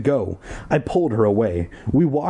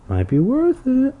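coaxing, much higher. Real, not acted. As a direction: tease it up high. Coaxing aside, high.

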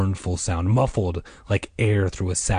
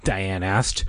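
ordinary delivery, nothing marked

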